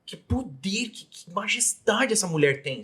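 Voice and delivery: high, softened voice of amazement; awestruck wonder. Retold or imitated. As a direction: marvel at it, high and drawn out. Retelling awe at the teacher.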